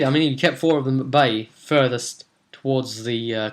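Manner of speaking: usual speaking voice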